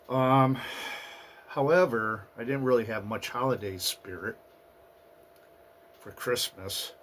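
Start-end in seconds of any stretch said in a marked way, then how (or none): none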